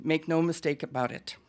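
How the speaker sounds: usual speaking voice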